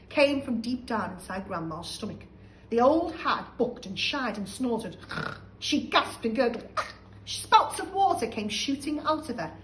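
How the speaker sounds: plain reading voice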